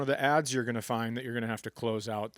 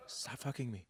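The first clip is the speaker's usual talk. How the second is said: very soft, hushed pretend aside off the phone; mock whispered aside during a call; 4 semitones lower, 17 dB quieter than usual. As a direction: hiss it away from the receiver. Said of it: "taking phone calls while you're making love".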